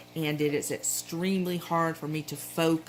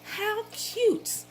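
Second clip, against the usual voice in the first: "How cute" squealed much higher; delighted squeal. Retold or imitated. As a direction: gush over something adorable in a high squeal. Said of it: "I was like".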